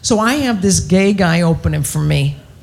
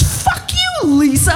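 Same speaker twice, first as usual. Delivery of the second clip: very high-pitched mock God cursing; squeaky angry God; 9 semitones higher than usual. God cursing the comic back in a quarrel, high mock voice. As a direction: shoot back in a much higher voice.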